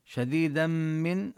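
شديدا من with the tanween merged into the mim and a held nasal hum; nasal merge. Shown as a demonstration. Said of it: "حكمه حكم الإدغام بغنة".

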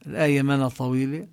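ordinary delivery

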